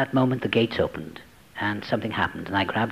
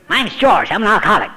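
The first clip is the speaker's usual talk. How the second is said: high, loud, booming welcome; cheerful hearty introduction, higher and louder; hearty greeting; 8 semitones higher, 6 dB louder than usual. Retold or imitated. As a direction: pump it up like an eager handshaker. Hearty George greeting retold.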